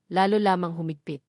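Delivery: ordinary delivery, nothing marked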